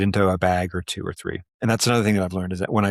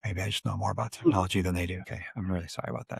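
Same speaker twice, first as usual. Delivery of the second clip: quiet aside; 9 dB quieter than usual. Real, not acted. Off-mic aside while paused.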